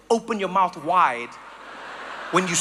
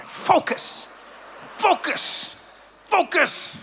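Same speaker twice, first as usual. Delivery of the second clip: higher, intent "focus" over and over; serious Dutch cyclist; 7 semitones higher, much slower than usual. Acted. Dutch rider going somewhere, intensely serious chant. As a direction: repeat it like a stern mantra. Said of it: "in such a serious way".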